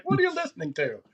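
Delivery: high-pitched mock disapproving relative; high, put-on incredulous question; baffled relative. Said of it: "all of us had that relative".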